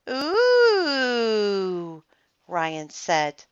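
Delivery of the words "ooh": long, high teasing "ooh". A narrator's reading. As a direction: taunt like a schoolkid, drawn out and high. Kid teases about a supposed kiss.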